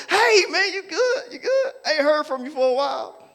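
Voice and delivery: high, laughing fake-friendly hello; fake friendly greeting. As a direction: greet him sweetly while faking it. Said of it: "Just as fake as you wanna be".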